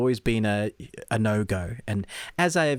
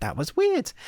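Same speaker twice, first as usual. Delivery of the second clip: mock bemused; 10 semitones higher, slower than usual. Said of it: "and I was like".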